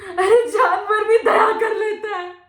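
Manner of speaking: loud, high shout of accusation; anguished outcry. Acted. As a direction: cry out at their cruelty. (दर्द भरे गुस्से में चिल्लाना)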